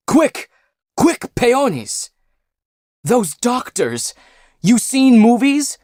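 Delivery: panicked high-pitched orders; injured friend barking panicked orders at his friends; frantic injured man. Acted.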